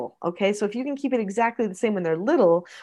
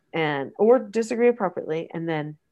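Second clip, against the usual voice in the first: normal talking voice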